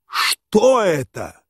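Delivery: high, long-drawn exclamation; aghast cry. A narrator's reading. General appalled by the insult, crying out. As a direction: stretch it out in shocked protest. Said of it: "вскричал Иван Ильич".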